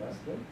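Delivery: Esten with emphasis on the final syllable -ten; soft demo of Esten, stressing its last syllable. Shown as a demonstration. Teacher showing students the accent in Esten, last syllable stressed. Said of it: "let's put an emphasis on the".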